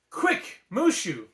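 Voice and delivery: loud urgent call. A narrator's reading. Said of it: "Mulan called".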